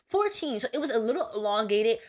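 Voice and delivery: usual speaking voice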